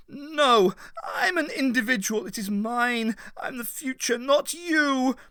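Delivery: high, loud desperate refusal; defiant shout. A narrator's reading. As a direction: fight her off with your voice. Vale resists the voice.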